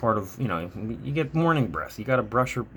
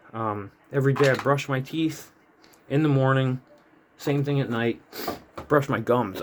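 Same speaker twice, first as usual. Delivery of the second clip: ordinary delivery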